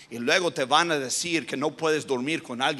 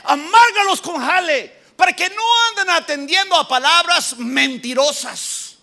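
much higher, loud shouted command; furious Pharaoh; 11 semitones higher, 6 dB louder than usual. Acted. Preacher voicing Pharaoh ordering the Hebrews punished, shouting. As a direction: bark the order like an angry king.

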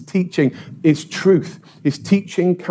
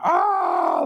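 imitated scream; 12 semitones higher than usual. Lad with broken leg on a driveway, screaming. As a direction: cry out high, re-enacting the pain. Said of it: "I'm screaming, like".

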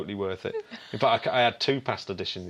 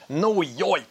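hammed-up New York shout, higher and louder; mock New York accent; 8 semitones higher, 5 dB louder than usual. Mock New York accent while naming the city.

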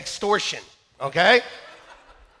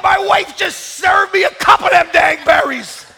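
laughing shout; 7 semitones higher, 7 dB louder than usual. Retold or imitated. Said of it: "I'm like".

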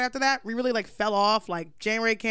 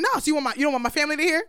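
higher and louder, daring him to answer; confronting; 5 semitones higher, 4 dB louder, much faster than usual. Retold or imitated. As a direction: throw it right in his face. She confronts him loudly.

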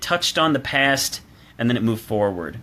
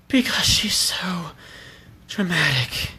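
breathy, whispered, higher dramatic actress voice; Liv Tyler impression; 7 semitones higher, slower than usual. Mocking Liv Tyler's dramatic love-interest delivery: breathy whisper. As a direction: breathe it out like a swooning heroine. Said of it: "hearing her talk like this".

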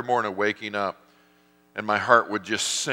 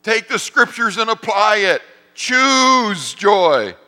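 much higher and louder booming divine command, slow on work it out; voice of God; 13 semitones higher, 11 dB louder, slower than usual. Retold or imitated. God answering the miserable pastor, booming and high. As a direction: proclaim it from above like God himself. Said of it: "And God's like".

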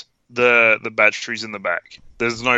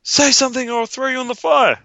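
much higher, strained mock threat; mum's threat; 13 semitones higher than usual. Mum holds doll up to the fire, high-pitched threat.

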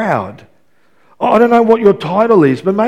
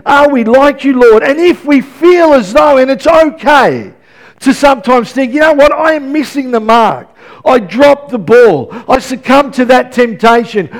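loud, raised, emphatic sermon voice; impassioned preaching; 6 semitones higher, 6 dB louder than usual. Real, not acted. Preacher urging church to trust the promises, forceful preaching. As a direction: drive each point home to the congregation.

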